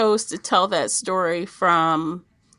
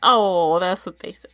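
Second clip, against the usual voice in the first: normal talking voice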